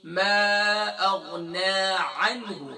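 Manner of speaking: "ما اغنا عنہ" slow, with a clear mid-throat عین in عنہ; correct slow recitation. Shown as a demonstration. Teacher reciting the corrected phrase for the class.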